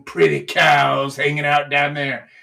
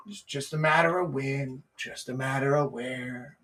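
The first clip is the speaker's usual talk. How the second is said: laid-back; 4 semitones lower, 6 dB quieter than usual. Shown as a demonstration. Acting the patient gator.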